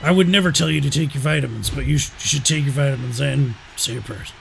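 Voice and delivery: loud, gruff wrestling-promo shout, brother; Hulk Hogan. Pretend. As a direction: bellow it like a hammed-up wrestling legend. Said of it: "Are you Hulk Hogan?".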